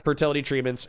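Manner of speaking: ordinary talk, nothing marked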